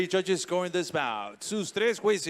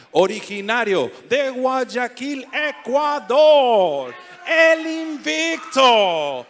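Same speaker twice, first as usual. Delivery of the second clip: drawn-out booming name call, much higher; big fighter introduction; 9 semitones higher, 7 dB louder, much slower than usual. Real, not acted. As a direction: stretch the name out like a ring announcer. Announcer calls fighter's name.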